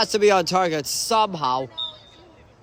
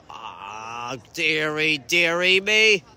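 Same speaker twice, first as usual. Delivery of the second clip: ordinary delivery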